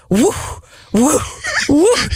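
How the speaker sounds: rising squawks, woof woof woof; imitating a panicked parrot squawking; parrot squawk imitation. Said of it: "and he goes".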